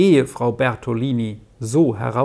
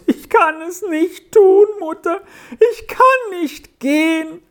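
put-on much higher, loud, tearful child's cry; weinend herausgestoßen; 3 dB louder than usual. A narrator's reading. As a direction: burst it out sobbing, voice pitched way up. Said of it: "weinte laut und stieß heraus". (laut weinend, hoch und gepresst herausgestoßen)

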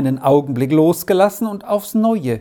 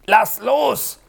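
choked plea, pitched higher; struggling for air in a headlock; strained gasp of a pinned bully; 8 semitones higher than usual. A narrator's reading. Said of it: "keuchte Eber".

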